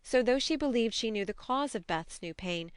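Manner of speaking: usual speaking voice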